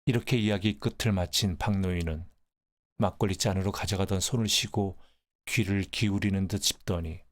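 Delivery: plain reading voice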